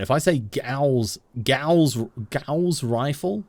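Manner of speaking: "Gauss" with an ow vowel; Gauss pronunciation. Shown as a demonstration. Streamer testing if it sounds right, "Gauss" like house. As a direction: test out "Gauss" rhyming with house, drawn out. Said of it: "it just doesn't sound right".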